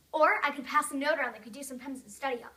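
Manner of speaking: plain reading voice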